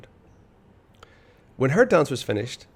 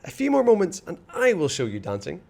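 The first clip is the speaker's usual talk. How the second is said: high, eager start, settling down; eager boast; 7 semitones higher, 3 dB louder than usual. A narrator's reading. Ivan boasting to the children, excited and high. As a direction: promise it with excitement. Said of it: "cried Ivan".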